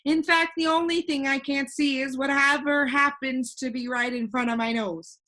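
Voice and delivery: put-on a cheerful know-it-all boy floating in the air; voiced boy Alec. Alec introduces himself.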